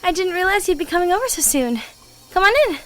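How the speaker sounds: called out loud and high; loud happy greeting. Acted. Partner greeting her early visitor at the door, called out loud. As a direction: call a cheery welcome across the room.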